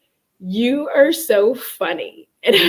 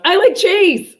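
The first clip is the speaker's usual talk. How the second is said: yelling out; 10 semitones higher, 5 dB louder than usual. Pretend. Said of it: "Have you tried just yelling out".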